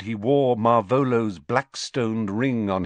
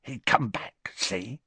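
stupidly; 5 dB quieter than usual. Acted. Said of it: "he added stupidly".

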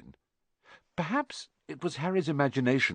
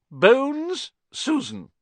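higher, louder stern teacher's call; McGonagall announcing; 5 dB louder, much slower than usual. A narrator's reading. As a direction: announce it crisply to the new students. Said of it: "McGonagall calls names".

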